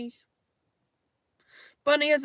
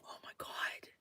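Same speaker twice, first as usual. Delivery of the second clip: shocked whisper; 24 dB quieter than usual. Real, not acted. Reacting to the chat message.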